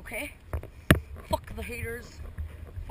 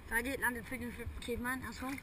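ordinary talk

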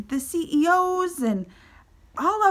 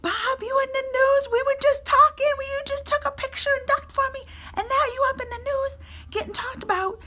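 much higher, put-on hurt whine; whiny complaint; 11 semitones higher than usual. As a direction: complain to Bob like he forgot you. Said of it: "I was like".

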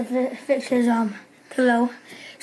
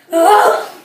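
loud laughing shout; laughing yell; 6 semitones higher, 10 dB louder than usual. Real, not acted.

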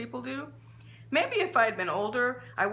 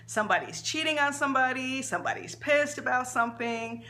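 plain reading voice

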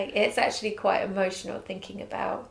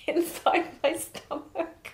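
laughing; 8 semitones higher, slower than usual. Real, not acted. Amazed at baby inside her.

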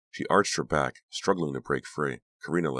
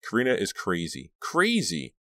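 higher, climbing to a high exclamation; stunned realization; 5 semitones higher than usual. A narrator's reading. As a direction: let disbelief build to the last word. Shocked realization repeated, rising.

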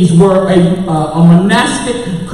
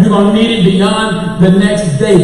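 usual speaking voice

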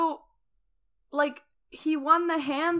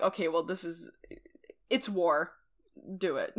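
gruff elders' voice; 6 semitones lower, 7 dB quieter than usual. Retold or imitated. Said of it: "then they were like".

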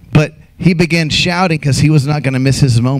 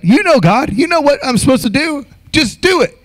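high, pushy mock demand; lightly hammed-up impatient believer; 9 semitones higher than usual. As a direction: nag God like a spoiled child.

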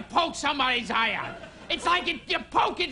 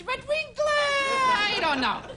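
high doubtful voice; 11 semitones higher, slower than usual. Retold or imitated. People of the 1400s quoted.

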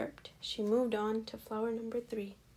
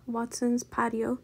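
ordinary delivery

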